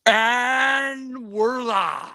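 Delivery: slow drawn-out hype call; announcer hype. Acted. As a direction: stretch it out like a ring announcer. Going live on stream.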